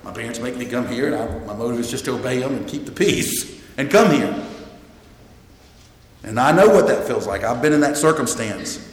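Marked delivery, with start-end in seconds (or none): 0.0-4.3 low, subdued, grudging admission; half-hearted youngster owning up quietly; reluctant younger churchgoer. Acted. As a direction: lightly voice a dutiful kid, lower and softer. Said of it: "some of us younger ones might say".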